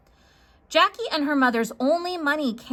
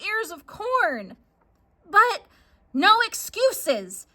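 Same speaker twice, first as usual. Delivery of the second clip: angry mother; 6 semitones higher than usual. Acted. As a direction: tear into her for the bad deal. Mom rants at Jackie.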